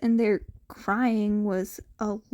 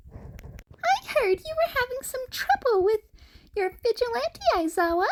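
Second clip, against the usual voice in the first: high, pleasant little creature voice, much higher; a polite, cheery small creature; pleasant rat principal; 13 semitones higher than usual. A narrator's reading. The rat principal greets Aizawa, high and pleasant. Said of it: "the rat said pleasantly".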